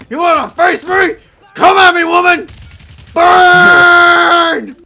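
screaming taunt, very high and loud; taunting shout. Real, not acted. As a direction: roar the challenge at the enemy. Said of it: "not blowing out your mic".

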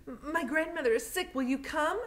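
put-on high, anxious girl calling for help; urgent young girl. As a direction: beg the doctor, breathless and worried. Lena pleading for sick grandmother.

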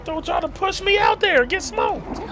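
high indignant shout; shouted protest at teammate; exasperated yelling. Real, not acted.

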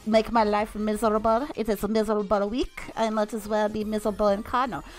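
ordinary talk

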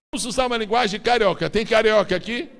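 fired-up preacher calling to the crowd; preaching at full force. Real, not acted. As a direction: lift the room with a loud call. Preacher builds up, loud.

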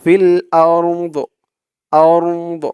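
আরদ with the vowel dropped, ending on a still দ; stop on আরদ. Shown as a demonstration. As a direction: halt on আরদ, closing on দ. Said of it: "উচ্চারণটা হবে".

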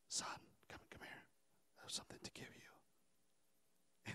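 barely audible whisper; hushed, secretive whisper from a father. Acted. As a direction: whisper as if beckoning a son closer. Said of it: "how come you're whispering to me".

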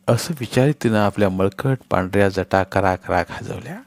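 ordinary delivery, nothing marked

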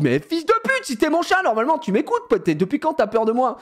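high, indignant pleading; exasperated calling. Retold or imitated. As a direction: scold the runaway cat, voice up high. Scolding the cat.

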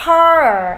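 slow "car" with a long open A into R; AR sound. Shown as a demonstration. Teacher showing the AR sound, drawn-out "car". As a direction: stretch "car" and curl into the R. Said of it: "Âm A R nhá".

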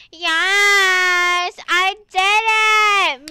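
loud, high, slowed victory cry; victory shout. Real, not acted. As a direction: shout it out in triumph. Finishing the obby.

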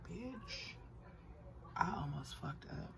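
hushed whispered aside. Real, not acted. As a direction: whisper it under your breath. Whispered aside after near slip.

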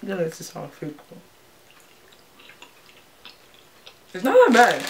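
chewing while talking; slightly muffled chewy speech; mouth full of candy. Real, not acted. Seen 0: Middle speaker eats candy at -5, jaw still working while talking.

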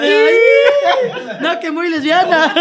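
very high squealing laugh; shrieking giggle. Real, not acted. Laughing at friend's joke.

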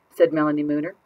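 ordinary delivery, nothing marked

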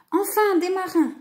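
high, delighted exclamation; exclaiming. A narrator's reading. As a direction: exclaim with relief, voice lifted. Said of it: "s'exclama Lou".

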